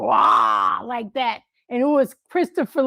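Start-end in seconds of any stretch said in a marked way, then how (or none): 0.0-0.8 Dracula growl. Retold or imitated. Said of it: "Dracula thing go".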